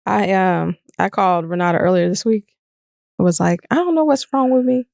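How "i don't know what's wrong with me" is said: higher imitation of herself, weepy and helpless; a self-parody, pitched up and plaintive; her voice of me. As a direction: mimic yourself sounding lost and teary. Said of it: "That's my voice of me".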